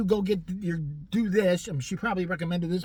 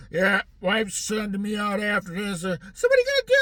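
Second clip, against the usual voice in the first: chatty man in a mock voice; 2 semitones higher, 4 dB louder than usual. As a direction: ham up the small talk. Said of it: "he's like".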